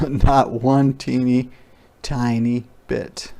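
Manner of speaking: plain reading voice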